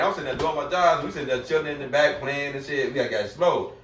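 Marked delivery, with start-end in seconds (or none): none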